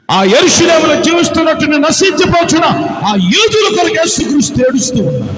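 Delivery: loud Telugu pulpit delivery; fiery preaching. Acted. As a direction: preach it out to the crowd. Preaching at full force.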